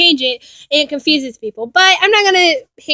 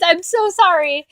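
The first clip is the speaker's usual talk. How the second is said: usual speaking voice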